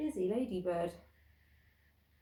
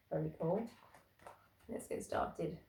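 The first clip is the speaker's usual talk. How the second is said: usual speaking voice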